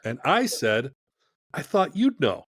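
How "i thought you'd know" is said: high, slightly louder, sheepish answer; sheepish reply. Retold or imitated. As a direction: toss the question back, a little helpless. Answering John backstage, sheepish.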